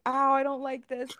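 high mock whine of the boyfriend; mock whiny boyfriend. Mocking the boyfriend on the cramp machine, high whiny. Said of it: "crying and is like".

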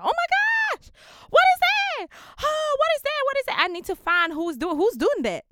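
put-on high, loud American accent, thrilled; American accent full of excitement; excited American accent. Hearing drill beats in the studio, excited American accent. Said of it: "I had to switch to an American accent".

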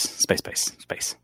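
hissed, fading whisper of space; drawn-out s like a snake. Pretend. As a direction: stretch each s like a snake.

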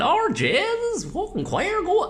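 high, slow, drawn-out Wexford impression; thick Wexford accent. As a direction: stretch it into a high-pitched broad Wexford. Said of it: "the Wexford middle".

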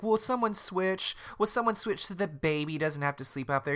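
high-pitched acted plea to the group; mock exasperated counselor. Counselor asks bunkmates to switch, high mock exasperation. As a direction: pitch it high, wearily asking for a volunteer.

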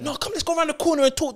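a high put-on voice for the friend daring him; mock challenge.

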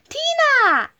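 high, excited call; storybook shout of greeting; shouted name. A narrator's reading. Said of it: "shouted Fluffy".

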